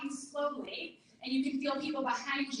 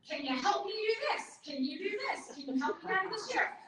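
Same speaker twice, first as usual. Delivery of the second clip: higher, bright mock-polite asking voice; mom asking for help; 5 semitones higher than usual. Teacher imitating her mom asking strangers for help, higher and sweet. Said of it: "She used to ask all the time".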